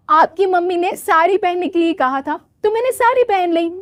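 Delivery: a hint of the naive bride explaining; young bride voice. Soumya answers in-laws. (हल्की भोली बहू की आवाज़)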